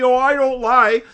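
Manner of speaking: a hammed-up claim of innocence; mock innocent protest. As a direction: parody people insisting they never lie. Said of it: "When people tell me".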